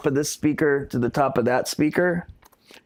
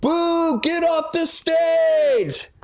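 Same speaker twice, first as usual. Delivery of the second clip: high, drawn-out mock yell; retold heckler yell; 13 semitones higher, much slower than usual. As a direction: boo and heckle, stretching the last word. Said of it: "the guy next to me yells".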